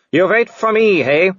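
high-pitched villainous werewolf taunt; growling werewolf. A narrator's reading. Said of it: "he growled".

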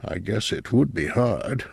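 soft, musing aside; reflective. A narrator's reading. Boss muses on the patient's memory, softly reflective. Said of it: "McLean reflected".

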